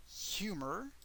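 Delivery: "humor" as HYOO-mer, high with a rising end; English "humor", stressed on the first syllable; English pronunciation. Shown as a demonstration. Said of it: "the pronunciation is different".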